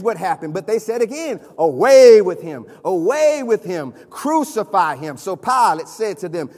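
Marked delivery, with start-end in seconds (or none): 1.6-5.2 loud, drawn-out crowd demand; crowd's outcry. Acted. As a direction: call it out as an angry mob, stretching the key words. Preacher acts out the shouting crowd.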